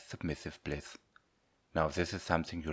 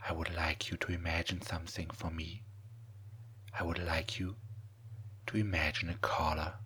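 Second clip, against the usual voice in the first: right in your ear; 4 dB quieter than usual. Acted. Hypnosis trance induction, whispered.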